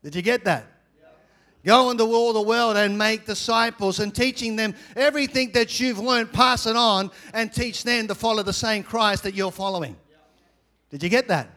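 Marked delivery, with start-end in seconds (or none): 1.6-10.0 loud, high, driving sermon voice; full-force preaching. Acted. Preacher charging the congregation to make disciples, full-force preaching. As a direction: declaim the commission to the room.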